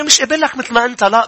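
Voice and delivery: ordinary talk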